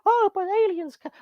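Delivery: high-pitched mock protest; Scully. Host mimics Scully arguing for aliens, high mocking voice. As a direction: squeak out a caricature of her objecting. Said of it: "She's like".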